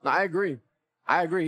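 usual speaking voice

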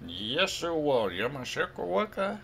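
half-hearted parody yell, pitch climbing; playful imitation of a frustrated parent's cry; mock yell. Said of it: "and you yelled".

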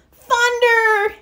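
high, loud terrified scream; scared scream. Acted. Franklin panicking at the thunder, screaming. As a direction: scream in fright at the crash. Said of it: "he screamed".

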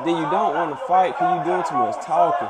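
shouting at Prime that he talks instead of fighting; heated shout. Real, not acted. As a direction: yell it at him, fed up.

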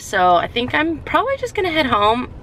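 ordinary talk, nothing marked

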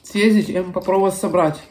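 speaking between spoonfuls; talking while eating. Real, not acted. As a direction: mouth partly full of soup. Seen 5: Spoons soup to mouth repeatedly between words, lips working on food.

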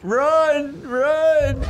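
urgent shout. Real, not acted. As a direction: yell at him to flee. Fleeing enemies in game.

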